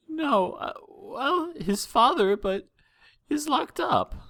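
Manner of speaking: high, trembling voice of a frightened mare; a worried mother holding back tears; tearful mother. Acted. Frightened mother telling the princesses of her missing son, high and tearful. Mother hesitates about father.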